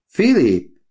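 high, loud rebuke of his name; sharp call. A narrator's reading. Daughter rebukes her father, sharp loud call. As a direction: snap his name in protest.

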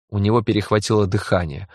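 plain reading voice

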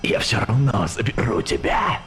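soft, eerie hissing menace; hissing ghost voice. A narrator's reading. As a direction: threaten in a quiet hiss. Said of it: "Слегка свистяще донеслось с той стороны".

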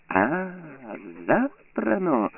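high, slow enraged exclamation; flaring up. A narrator's reading. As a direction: explode in mocking rage. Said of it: "розпаленів Круглоокий".

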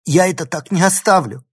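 high, loud, upset cry; tearful protest. A narrator's reading. Girl in tears threatens professor, crying out in protest. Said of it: "всхлипнула девочка".

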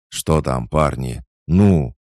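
nervous call rising to a loud demand; frightened, wavering voice. A narrator's reading. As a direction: call to the men with growing fear. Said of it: "Голос Фомы испуганно дрогнул".

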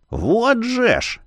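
high, slow scoffing exclamation. Acted. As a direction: scoff it out, drawn out. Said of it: "Бахамут хмыкнул".

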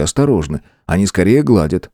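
usual speaking voice

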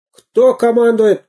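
громко, удивлённо, выше; вдруг опомнись и возмутись; опомнившийся пират. Acted. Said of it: "опомнился пират". (пират вдруг опомнился: удивлённый громкий вопрос)

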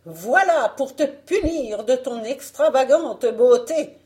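high, loud, theatrical triumph; gloating wicked queen. Acted. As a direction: declaim your revenge over the victim. Reine triomphe sur Blanche.

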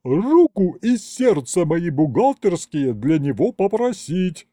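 high-pitched, exasperated bark; irritated matron's outburst. A narrator's reading. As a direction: fire it back at him impatiently. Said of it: "рявкнула Агриппина Падловна".

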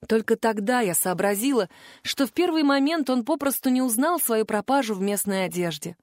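ordinary delivery, nothing marked

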